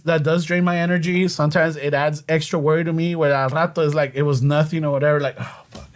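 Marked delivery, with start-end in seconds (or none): 5.4-5.9 whispered curse. Retold or imitated. Inner reaction, whispered.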